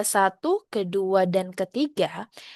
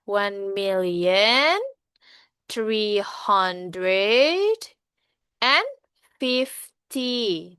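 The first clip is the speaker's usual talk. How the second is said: very slow "One million three hundred and fifty", left hanging chunk by chunk until "fifty" falls; drawn-out prompting; much slower than usual. Shown as a demonstration. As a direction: stretch "One million", "three hundred", "and" with rising ends, pausing after each. Prompting students to complete the number.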